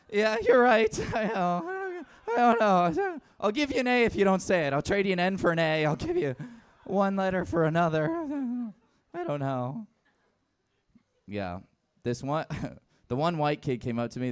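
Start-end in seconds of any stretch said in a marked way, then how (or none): 0.1-9.9 high, loud, hurried bargaining; flustered pushover teacher. Acted. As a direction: cave in and bargain in a raised, giggly voice. Acting out his flustered reply to kid.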